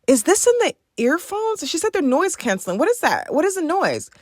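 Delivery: panicky, what is that noise; startled and overstimulated; alarmed confusion. Retold or imitated.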